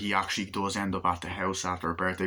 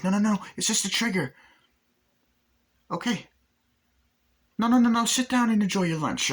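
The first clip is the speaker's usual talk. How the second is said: much higher, pleading fast; squeaky panicked manager; 13 semitones higher, 4 dB louder than usual. Retold or imitated. Manager begging the gunman to sit, squeaky and higher.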